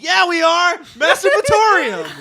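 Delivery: high and loud, laughing; proud shout. Retold or imitated. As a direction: boast it loudly. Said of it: "And I'm like".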